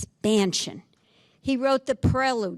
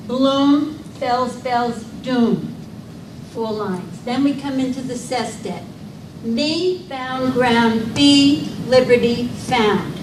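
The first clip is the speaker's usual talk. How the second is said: each rhyme word called out much louder; punching the rhymes; 7 dB louder than usual. Shown as a demonstration. Teacher calls out the sonnet's rhyme words to students, loud. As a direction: hammer every rhyme word home. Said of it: "A, B, B, A".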